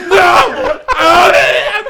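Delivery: very high, very loud mock scream, laughing through it; hammed-up anguished wail. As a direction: wail it like a melodramatic wreck, slowing on the last cry. [name] despairs again.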